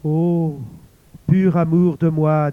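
loud, ringing lover's call; the lover's voice crying out, loud and ringing; impassioned cry. A narrator's reading. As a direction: call to her with full heart. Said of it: "pareille au chant du cœur du monde".

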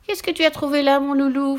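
high witch cooing at her dog. Acted. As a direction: coo it to the dog like a sly witch. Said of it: "fit la sorcière".

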